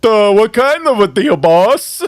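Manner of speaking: hammed-up dopey henchman; dopey mocking imitation. Host joins in mocking the kid's line, loud and dopey. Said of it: "He sounds like this".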